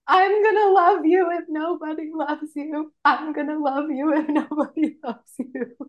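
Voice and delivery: a light tipsy pledge, laughing it out; tipsy self-comfort. Retold or imitated. Drunk self-comfort to Coldplay.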